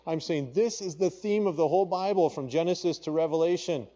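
ordinary talk

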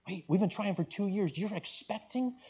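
high, disbelieving question, acted; astonished, stretching out the realization at the end; incredulous husband. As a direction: react in hushed, high-pitched disbelief. Husband reacts to pregnancy news.

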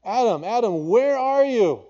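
high, loud, searching call; God calling out. Acted. God seeking hiding Adam, a ringing high call.

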